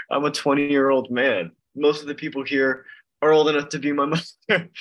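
high, loud, breaking into laughter; amused, laughing. Real, not acted. Young member joking about older members, laughing. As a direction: joke loudly, laughing before the last word.

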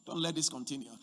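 dropped low and soft, almost to herself; hushed plea. Acted. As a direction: pull the plea right down to a murmur. Repeated, much softer.

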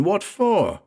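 high, incredulous demand; aghast question. A narrator's reading. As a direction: voice leaping up in disbelief. Aghast friend demands a reason.

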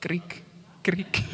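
soft, short imitated cricket chirp, twice; mock cricket chirps. Preacher filling the congregation's silence, mock cricket chirps.

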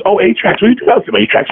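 lightly voiced high chirpy shop lady; cheerful clerk piping up from across the store, high; other shop lady.

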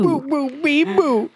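high sing-song boo boo beep boo; Pablo Francisco bit. Retold or imitated. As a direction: chant it high, playful. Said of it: "Shout out to Pablo Francisco".

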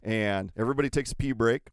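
usual speaking voice

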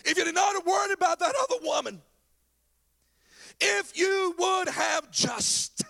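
high, loud frustrated reproach; anguished outburst. Acted. Jairus silently blames Jesus for delaying, in anguished shouts. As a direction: cry it out, breaking off unfinished. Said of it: "in the mind and the heart of Jairus".

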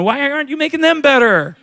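child mimicking back at parents, pitched high; kid's voice. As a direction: whine it like a kid blaming mom and dad. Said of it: "the kids are looking at the parents going".

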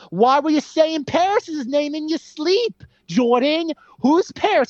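high, slow mock accent as the angry wife; mock Margot Robbie. Mocking the wife confronting her husband, high and slow. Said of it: "she's like".

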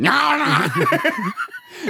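high, drawn-out Arnie-style cry; mock dying noise. Host imitates Arnie as the dying crocodile, mock death cry. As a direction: let out a hammed-up dying wail. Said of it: "Arnie does a great dying, uh, sound".

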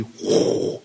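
breathy, voiceless whoosh; whooshing light. Retold or imitated. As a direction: blow out a rush of air for the light. Imitates the rush of light.

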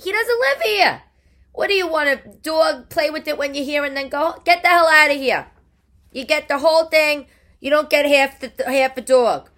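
loud, high-pitched telling-off; indignant rant. Acted. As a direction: lay into him, loud and high. Scolding absent partner over dog.

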